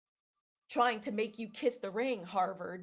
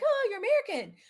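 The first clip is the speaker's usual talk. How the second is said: high-pitched, louder retold exclamation; excited exclamation; 13 semitones higher, 6 dB louder than usual. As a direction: imitate her pouncing on the accent. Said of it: "heard my accent and she's like".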